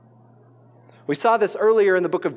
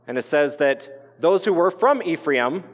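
ordinary talk, nothing marked

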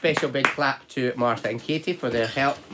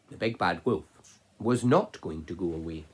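plain reading voice

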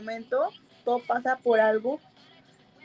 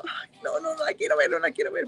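mock refusal; 5 semitones higher than usual.

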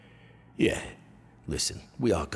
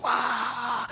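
very high amazed wow; kids' wow; 4 dB louder than usual. Retold or imitated. Kids at the pool react to the diaper falling, very high. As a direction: gasp of shocked delight. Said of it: "the kids are like".

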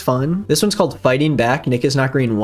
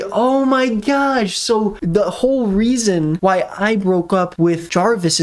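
high, slow hammed-up shock; dramatic realisation; 6 semitones higher, slower than usual. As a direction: draw out the revelation, pitched up. Dramatic revelation in story.

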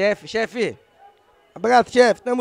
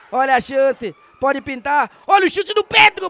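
rising excitement; 5 semitones higher, 6 dB louder than usual. Real, not acted. Chance building.

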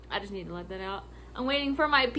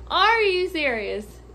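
slow, higher, drawn-out incredulity; exasperated disbelief; 4 semitones higher, 4 dB louder, slower than usual. Retold or imitated. Clerk asked to measure furniture, slow exasperated disbelief. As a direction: stretch it out, fed up.

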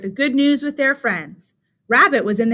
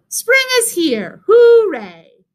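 high, slow, exclaimed celebration; joyful cheer; 10 semitones higher, 6 dB louder, much slower than usual. A narrator's reading. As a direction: cheer it out, ending in a loud hooray. Rabbit finally cheers.

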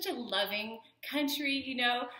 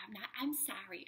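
whisper; 3 semitones lower, 9 dB quieter than usual. Real, not acted. Playful whispered apologies.

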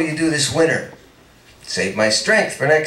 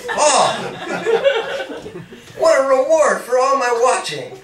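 laughing tease; 5 semitones higher than usual. Acted. Peggy's mock reply, laughing.

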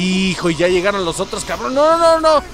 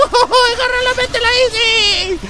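laughing; 13 semitones higher, 4 dB louder than usual. Real, not acted. Frantic gameplay, laughing while grabbing.

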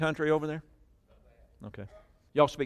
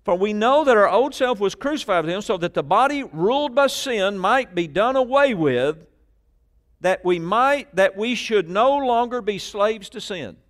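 forceful preaching; 9 semitones higher, 7 dB louder than usual. Real, not acted. Preacher declaring scripture to the congregation, forceful. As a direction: proclaim the verse at full force.

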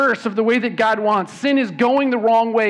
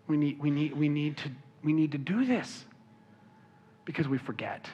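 hushed reflection; 4 semitones lower, 8 dB quieter than usual. Real, not acted. Pastor's hushed reflection after urging.

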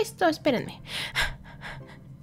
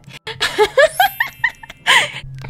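much higher, squealing laugh. Real, not acted. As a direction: let out a high squeal of laughter. Laughs after the ban joke.

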